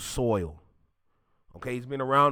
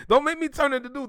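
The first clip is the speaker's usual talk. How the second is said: playful threat; 10 semitones higher, 5 dB louder, faster than usual. Real, not acted.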